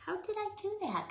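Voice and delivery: surprised. Real, not acted. Surprised at own mistake.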